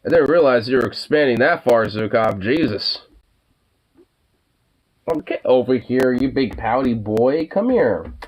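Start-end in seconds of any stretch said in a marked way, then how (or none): 5.1-8.1 high-pitched pet-talk, rising at the end; playful high coaxing. Real, not acted. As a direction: call the pouty dog over in a raised voice. Coaxing the pouting dog over.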